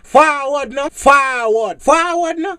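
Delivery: loud hyped call for a rewind; excited crowd shout. Retold or imitated. Dancehall crowd calling rewind.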